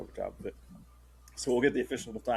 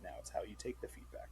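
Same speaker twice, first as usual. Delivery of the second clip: whispering; 2 semitones lower, 12 dB quieter than usual. Real, not acted. Whispered aside.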